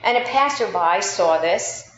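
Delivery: normal talking voice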